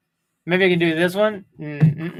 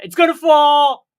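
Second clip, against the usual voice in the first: mock panic; 7 semitones higher, 10 dB louder than usual. Acting out worry.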